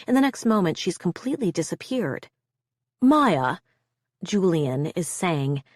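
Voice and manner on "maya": insistent. A narrator's reading. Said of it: "in an insistent tone".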